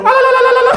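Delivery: very high, loud ululating trill; loud ululation. Shown as a demonstration. Comic showing the crowd's laugh, high loud ululation. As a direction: let out a piercing la-la ululation.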